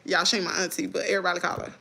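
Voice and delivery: usual speaking voice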